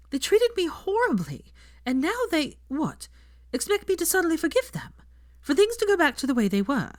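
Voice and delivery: hurt and frustrated, pushing through; frustrated Hermione. Acted.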